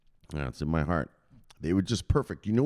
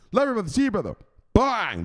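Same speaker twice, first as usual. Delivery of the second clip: high, loud catchphrase yell; hype shout; 13 semitones higher, 5 dB louder than usual. Pretend. Hyping the enforcer, loud high shout. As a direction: yell it like a big entrance.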